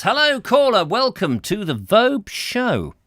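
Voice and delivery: high, loud sing-out welcome; big, drawn-out phone-in greeting; show host greeting. Acted. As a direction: hail the caller like a hammy radio host.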